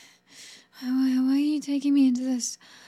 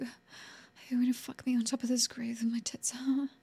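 softer, whispered dread; fearful whisper; 8 dB quieter than usual. Acted. Woman asking her captor in dread, whisper. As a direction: whisper the question in fear.

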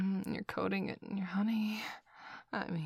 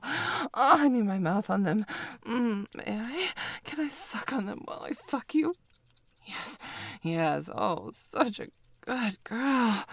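higher and much louder, breathless with pleasure; passionate moaning; 7 semitones higher, 6 dB louder than usual. Acted. Lover at the peak of passion with partner, loud moaning. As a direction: let the passion run over, loud and high. Passionate climax of intimate scene.

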